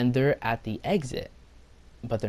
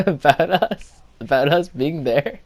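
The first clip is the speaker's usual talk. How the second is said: loud, high, through real laughter; cracking up while talking; laughing; 6 semitones higher, 7 dB louder than usual. Real, not acted. Storyteller relieved nobody found out, laughing loudly.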